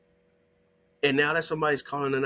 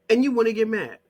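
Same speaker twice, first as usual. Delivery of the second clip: louder and higher, exasperated; exasperated louder take of you wanna get mad; raised voice; 6 semitones higher, 4 dB louder, faster than usual. Real, not acted. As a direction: throw it out loud at them. Repeated line, first take louder.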